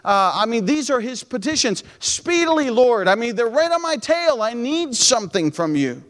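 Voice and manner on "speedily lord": high, pleading cry to the Lord; urgent plea. Acted. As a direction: beg God to hurry. Pastor voicing David again.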